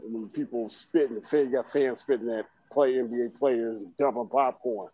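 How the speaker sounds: usual speaking voice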